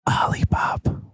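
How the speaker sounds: tiny whispered secret. Retold or imitated. Daughter whispering the secret word back to dad. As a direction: breathe the word into his ear. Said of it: "leans into my ear and she's like".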